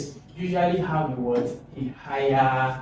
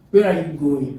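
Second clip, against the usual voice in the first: loud "Where", pitch falling to "going"; "Where are you going" louder, falling at the end; WH-question intonation; 7 dB louder than usual. Shown as a demonstration. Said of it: "In W H question".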